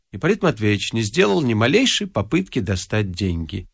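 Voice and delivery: ordinary delivery, nothing marked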